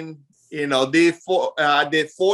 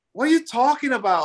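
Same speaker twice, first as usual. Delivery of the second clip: higher-pitched puzzled question; baffled listeners; 8 semitones higher than usual. Retold or imitated. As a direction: imitate unit mates who can't understand you. Mimics baffled unit mates.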